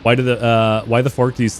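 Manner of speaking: usual speaking voice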